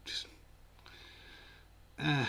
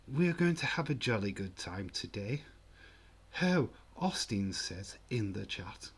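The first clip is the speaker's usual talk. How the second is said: caricatured TV Englishman accent; posh English accent. Shown as a demonstration. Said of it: "what you expect from an English accent".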